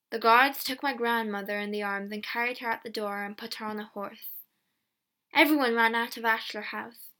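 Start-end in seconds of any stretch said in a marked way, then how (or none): none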